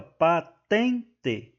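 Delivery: "patente" very slowly as pa, ten, te; teacher sounding out "patente" piece by piece; split into syllables. Shown as a demonstration. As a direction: break "patente" into three separate syllables. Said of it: "Aqui, ó".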